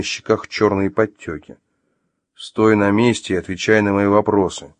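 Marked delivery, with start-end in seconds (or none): none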